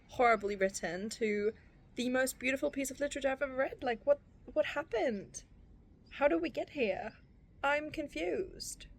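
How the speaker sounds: high, wobbly, overwhelmed through tears; sobbing reader pleading with her book; tearful disbelief. Real, not acted. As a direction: marvel while crying.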